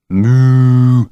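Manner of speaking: long, loud mock-cow call; loud moo. A narrator's reading. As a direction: bellow a drawn-out moo. Boy moos at cow again.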